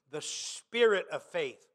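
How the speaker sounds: "spirit" lifted out of a low, soft phrase; stress on spirit. Real, not acted. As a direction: lean on "spirit", let the rest fall away. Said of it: "I emphasize that word".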